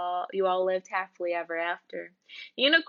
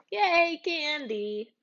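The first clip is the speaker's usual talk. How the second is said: excited cheer; 5 semitones higher, much slower than usual. Acted. Kid excited about candy.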